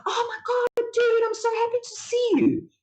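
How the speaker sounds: very high, gushing greeting; excited greeting. Retold or imitated. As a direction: squeal it out like seeing an old friend. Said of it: "I'm like".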